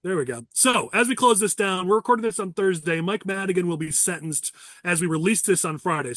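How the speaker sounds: normal talking voice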